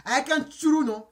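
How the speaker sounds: normal talking voice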